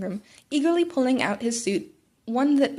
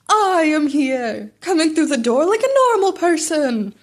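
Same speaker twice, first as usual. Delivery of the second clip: booming hero entrance; 4 semitones higher, 7 dB louder than usual. Acted. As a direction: boom it out like a grand hero arriving. All Might's entrance line.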